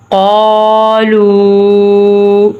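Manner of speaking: very slow, long-held recitation; slow drawn recitation. Shown as a demonstration. Teacher drills قالوا slowly for students. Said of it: "میرے ساتھ پڑھیں".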